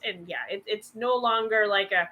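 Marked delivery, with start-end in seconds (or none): none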